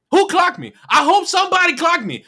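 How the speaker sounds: ordinary talk, nothing marked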